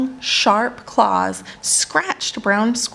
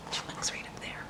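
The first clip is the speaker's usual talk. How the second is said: whispered aside; 20 dB quieter, faster than usual. Real, not acted. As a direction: lean in and whisper it. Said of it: "narrator aside".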